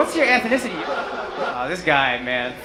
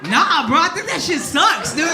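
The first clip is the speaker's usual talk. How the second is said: dismissive bro voice; 7 semitones higher than usual. Retold or imitated. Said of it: "and I'm like".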